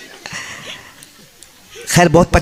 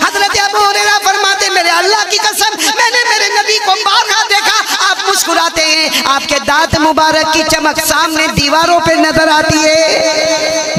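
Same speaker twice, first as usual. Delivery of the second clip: high, impassioned sermon voice; full-force pulpit preaching; fervent preaching; 10 semitones higher than usual. Acted. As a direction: preach it with rising fervour.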